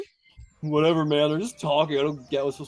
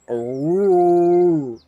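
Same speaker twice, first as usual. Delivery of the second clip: lowing ox; 3 semitones higher, 7 dB louder than usual. Retold or imitated. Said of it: "Angus just goes".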